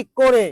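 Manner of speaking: করে said "ko-re", closed o; o-sound pronunciation. Shown as a demonstration. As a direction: say করে with an o-kar, "ko-re". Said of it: "উচ্চারণটা হবে ওকার দিয়ে". (করে-কে ওকার দিয়ে "কোরে" উচ্চারণ)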